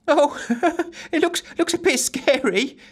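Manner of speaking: high, squeaky frightened giggle; nervous giggle. A narrator's reading. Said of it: "giggled Giggles".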